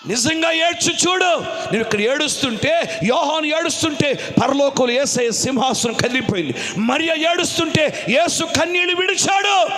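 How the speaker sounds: urgent, swelling sermon exhortation; full-force preaching. Real, not acted. Preacher urging believers to truly weep, impassioned. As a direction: drive it on in rising waves.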